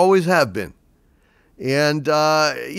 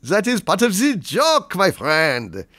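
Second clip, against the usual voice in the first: cheesy, lilting mock-French accent; knowingly hammy French accent; outrageous French accent; 5 semitones higher than usual. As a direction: lay the French accent on thick for laughs. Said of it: "my French accent is outrageous".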